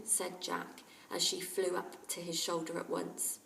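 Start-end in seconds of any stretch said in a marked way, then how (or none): none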